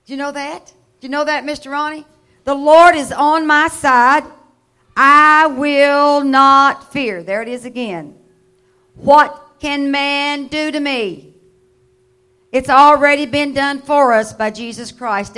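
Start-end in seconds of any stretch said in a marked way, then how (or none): 2.4-7.2 loud, drawn-out declaration; booming proclamation. Acted. Preacher proclaiming the Lord is on his side. 9.0-11.3 loud, slow, ringing scripture proclamation; preaching at full force. Acted. Preacher booming the psalm to his congregation.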